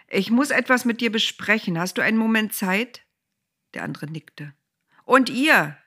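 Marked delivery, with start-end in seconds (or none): 0.1-3.0 raised voice. A narrator's reading. Boas addressing his relative. 5.0-5.8 loud, higher call to the men. A narrator's reading. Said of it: "rief Boas noch anderen zu".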